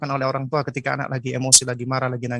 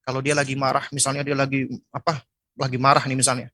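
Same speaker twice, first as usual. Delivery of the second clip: usual speaking voice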